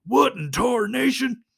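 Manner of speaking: high, loud cartoon cowboy. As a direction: holler it like an astonished old-West cartoon character. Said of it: "I'm not gonna do the voice".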